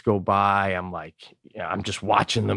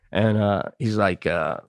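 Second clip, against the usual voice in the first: ordinary talk, nothing marked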